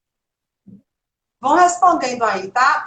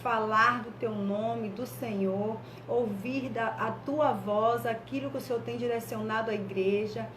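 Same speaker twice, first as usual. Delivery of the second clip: soft, measured congregational prayer; 11 dB quieter than usual. Real, not acted. Church leader asking God's presence over the gathering, praying softly. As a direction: lead the church gently in earnest prayer.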